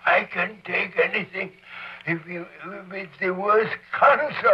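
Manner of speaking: high, building mock-heroic plea; dramatic plea. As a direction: swear you can bear anything.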